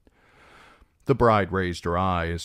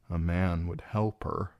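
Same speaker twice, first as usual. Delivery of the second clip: plain reading voice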